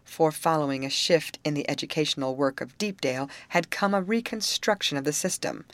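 ordinary delivery

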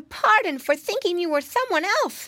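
put-on high-pitched, childlike piglet voice; high piggy squeal of an apology; little piggy boy. A narrator's reading. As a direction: voice a polite little pig apologizing.

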